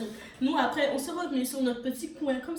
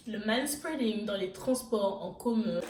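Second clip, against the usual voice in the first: ordinary talk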